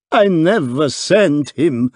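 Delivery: a touch of a foreign hotel keeper; distressed proprietor. A narrator's reading. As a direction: wring the words out in agony. Agonised foreign proprietor speaks.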